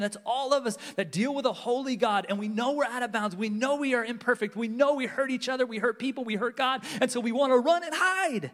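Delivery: ordinary talk, nothing marked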